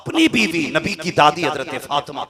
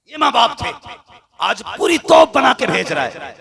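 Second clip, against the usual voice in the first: high, loud sermon shout; fiery preaching; 12 semitones higher, 6 dB louder than usual. Acted. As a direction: thunder at the audience like a preacher. Preacher thunders at parents. (ऊँची आवाज़ में जोशीला was)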